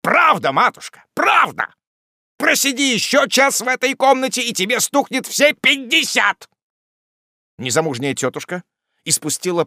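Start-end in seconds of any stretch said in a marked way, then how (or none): 0.0-6.5 high, loud, relentless jeer; taunting retort. A narrator's reading. Wardle taunts his sister about her age, mocking anger. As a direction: rub it in, voice pushed up.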